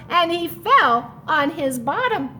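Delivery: plain reading voice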